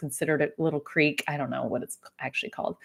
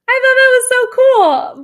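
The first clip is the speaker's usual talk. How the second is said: delighted; 11 dB louder than usual. Real, not acted. Delighted reaction to bird story.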